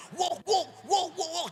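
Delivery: loud, high imitated barks; barking dog. Dog barking as child leaves house, imitated. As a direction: bark like an excited dog. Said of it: "anjingnya jungkong terus".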